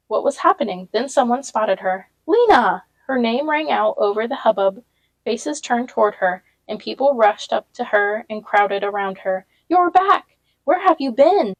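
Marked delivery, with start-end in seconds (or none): 2.3-2.8 high, loud call across a crowd; excited shout of a name. A narrator's reading. Someone calls out across hubbub. 9.7-11.6 high, loud, thrilled welcome; excited crowd voice; excited greeting. A narrator's reading.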